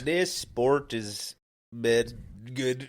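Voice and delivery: very slow Canadian slipping into Irish; badly faked Canadian accent, drawn out; botched Canadian accent. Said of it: "now you're talking Irish".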